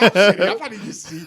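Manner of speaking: indignant tourist insisting on his seat, laughing; mock protest. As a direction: insist you're sitting there, half laughing. Acted protest at seat mix-up.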